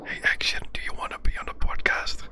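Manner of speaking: playful whisper to the donor; hushed teasing call. Pretend. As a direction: whisper the invitation like a secret.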